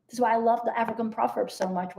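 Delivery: normal talking voice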